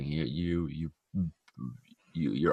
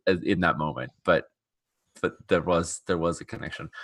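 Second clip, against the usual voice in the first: normal talking voice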